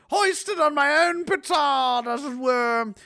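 high, slow theatrical toff voice; slow, high mock-posh delivery; pompous aristocrat. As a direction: declaim it like a stuffy lord. Pompous quote.

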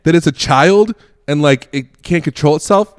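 loud, high protest through amusement; mock indignant. Dad to the heckler about his child, imitated indignant protest. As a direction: argue back like an affronted parent.

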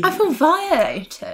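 mock outrage. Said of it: "mock outrage, joking".

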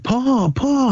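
put-on a small boy yelling a name from afar, voice up; kid calling out. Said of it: "and is like".

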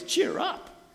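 high, incredulous talk-back; indignant retort. Retold or imitated. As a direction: throw their words back at them. Bartimaeus mocking the crowd.

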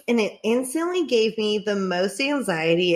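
normal talking voice

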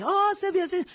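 high-pitched mock Chinese accent; hammed-up Chinese accent from a comedy bit; imitating Chinese restaurant accent. Comic acting out restaurant staff in her joke, mock Chinese accent. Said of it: "The people in my Chinese restaurant talk like".

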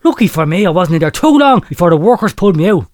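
ordinary delivery

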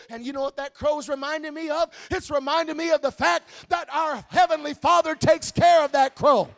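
loud, climbing preacher's shout; preaching at full force. Acted. Preacher rousing the congregation about God's care, shouting. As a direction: build it up and shout it out to the church.

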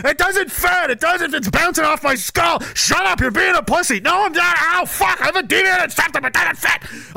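hammed-up pained whining; mock whiny protest. Reenacting nasal tube argument.

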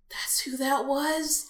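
high, drawn-out disbelief; stunned realisation. Retold or imitated. Fan retelling the moment she learned who it was, stunned. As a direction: act out a stunned, stretched-out question.

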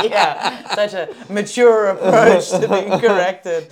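loud, drawn-out words through laughter; laughing. Real, not acted. Host admitting petty revenge on a partner, laughing self-mockery. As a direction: crack up at your own pettiness. Laughing at her own pettiness.

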